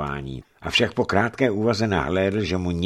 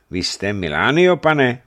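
polite address; 7 semitones higher, 7 dB louder than usual. A narrator's reading. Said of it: "oslovil zdvořile Benátčana".

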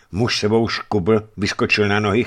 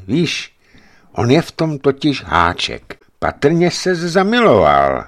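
higher, slower teasing guess; sly guess; 8 semitones higher, 6 dB louder than usual. A narrator's reading. Savelij guesses the giant's secret, higher and slow teasing. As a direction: tease him about being in love.

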